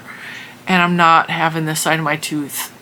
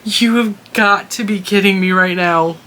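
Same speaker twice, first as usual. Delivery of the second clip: exasperated disbelief; 2 semitones higher, 3 dB louder, slower than usual. Retold or imitated. Exasperated reaction after laughing.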